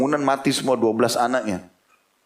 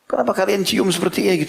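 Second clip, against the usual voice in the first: amazed question; 8 semitones higher than usual. Retold or imitated. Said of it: "tambah heran".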